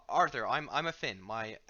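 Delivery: usual speaking voice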